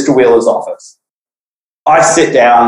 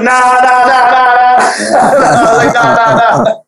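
higher, giggling nah nah; laughing denial; 9 semitones higher, slower than usual. Retold or imitated. Denying to his laughing friend, giggling nah nah. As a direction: deny it while trying not to laugh.

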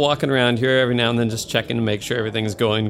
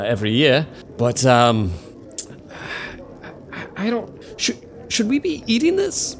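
nervous guest. Acted. GM voices nervous Footley.

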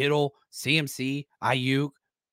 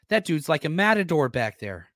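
ordinary talk, nothing marked

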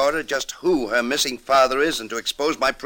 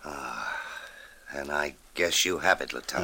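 defeated sigh; 8 semitones lower, 6 dB quieter than usual. Acted. Prisoner finally confesses, defeated.

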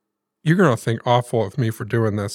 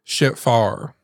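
Grandma's Southern saying. Retold or imitated. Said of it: "I had always heard Grandma say".

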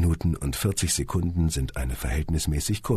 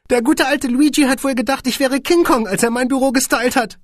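high, amused and chuckly; chuckling squeaky boss; 7 dB louder than usual. A narrator's reading. Boss jokes about his shelf, high and chuckling. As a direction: giggle through it. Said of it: "gluckste Van Hirsch".

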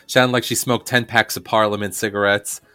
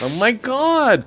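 much higher, slow amazed gasp; mock amazement; slower than usual. Co-host mocks a psychic amazed by herself, slow and high. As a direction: gush at your own success. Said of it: "she's always amazed by herself".

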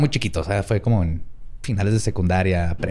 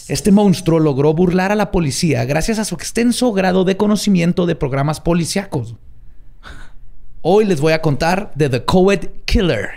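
raised, suspenseful narrator voice; dramatic story narration; 5 semitones higher than usual. Acted. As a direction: build dread like a true crime host.

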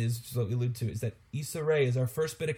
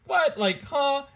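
baffled; 9 semitones higher, 4 dB louder, slower than usual. Real, not acted. Baffled by Hemsworth's muscles.

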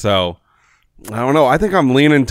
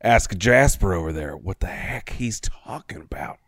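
confidential murmur; 6 semitones lower, 6 dB quieter than usual. Acted. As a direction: lean in and share the plan secretly. Leans over to confide a plan.

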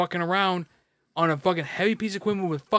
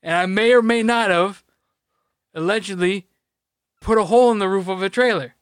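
higher, louder, hammed-up denial; mock indignation; 4 semitones higher, 5 dB louder than usual. Denying the trailer hole to his friend, mock-indignant. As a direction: play the wrongly accused, overly insistent.

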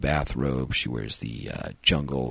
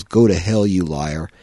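a bit higher and louder robot retort; lightly voiced robot telling him off, louder; snappy robot; 5 semitones higher, 10 dB louder than usual. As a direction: tell him off like a cheeky toy robot. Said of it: "The robot says".

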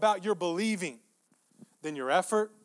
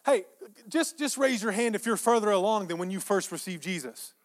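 usual speaking voice

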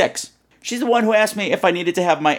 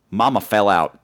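usual speaking voice

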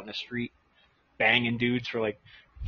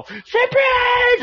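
high yelled surprise, way up; mock surprise shout; 4 dB louder than usual. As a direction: leap out and yell it. Prank crowd jumps out yelling.

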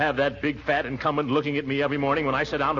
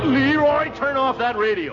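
shouting; 10 semitones higher, 5 dB louder than usual. Acted. Shouting over the radio.

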